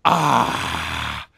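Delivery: low, drawn-out "ah" sigh; disappointed sigh. Shown as a demonstration. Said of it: "that's how you sigh when you are disappointed".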